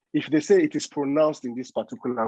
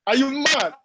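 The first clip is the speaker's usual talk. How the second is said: put-on higher, sharp Lagos-accented yell; Lagos street accent; 12 semitones higher, 4 dB louder than usual. Yelling at a reckless driver in Lagos traffic, higher Lagos accent. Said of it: "I don't know where that accent came from".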